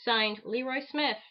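ordinary delivery

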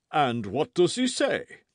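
self-sufficient. A narrator's reading. Porthos asks about the esquire's story, self-sufficient. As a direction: ask it slowly, full of self-importance. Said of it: "in a self-sufficient tone".

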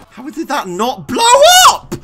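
very high, loud screams of disbelief; outraged shouting. Real, not acted. Player baffled that barrel won't explode, outraged shouting. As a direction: build from loud protest to full screaming.

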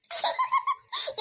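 high gleeful laugh at brother; mocking high laugh. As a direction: cackle at his fear. Sibling mocks scared brother.

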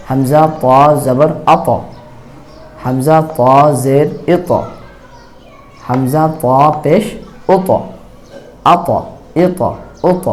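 "আত", "ইত", "উত" each ending in a pushed, bouncing ত; qalqalah on ta. Shown as a demonstration. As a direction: let the ত rebound, never soften it to "আপ". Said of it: "বলতেছি আপ না বলে বলতেছি আত". (ত-এ ধাক্কা দিয়ে কলকলা)

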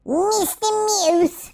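calling out to the moose player, teasingly; teasing sing-song call. Acted. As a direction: stretch the name out to tease. Calling a game character.

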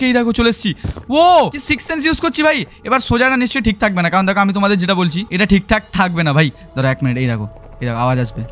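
normal talking voice

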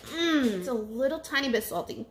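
talking while chewing; chewing a puffed snack; mouth full. Real, not acted. Seen 3: face clear; closed-lip chewing between words, holding another yellow puff.

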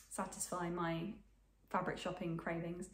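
usual speaking voice

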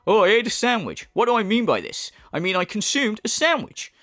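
hammed-up rhetorical explainer; mocking impression. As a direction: explain the obvious as if profound. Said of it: "when he says something obvious".

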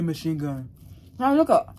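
chewing while talking; talking between bites; mouth full. Real, not acted. Seen 1: Bites sandwich at -1; food in mouth, chewing until about 2s.

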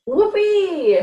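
high, loud whoop of delight; joyful storybook whoop; excited cheer. A narrator's reading. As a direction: cry it out gleefully like a happy little dog. Narrator voices dog's delight.

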